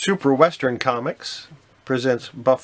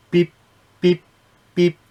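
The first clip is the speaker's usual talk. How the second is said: robotic beeps; 6 semitones higher, 6 dB louder than usual. Acted. Voices machine beeps.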